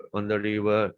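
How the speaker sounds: ordinary talk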